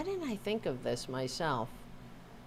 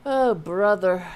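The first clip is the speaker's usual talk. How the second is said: louder, fed-up groan of a phrase; loud exasperated sigh-word; 2 semitones higher, 10 dB louder than usual. Real, not acted. Exasperated with her dog's noise, loud groan. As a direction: blurt it out, fed up.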